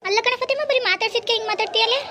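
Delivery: mother-in-law challenging the neighbour, high and loud; shrill mother-in-law. Acted. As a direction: pick a fight in a high, loud voice.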